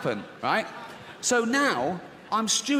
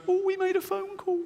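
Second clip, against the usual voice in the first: much higher, panicky mock voice; fearful inner voice. Nervous inner voice alarmed by the phone call, squeaky high. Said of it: "This one goes".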